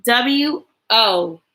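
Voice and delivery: slow, drawn-out spell-through. Shown as a demonstration. Teacher slowly spelling out the word the class is learning. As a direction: stretch the letters, then land the word. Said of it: "O sound".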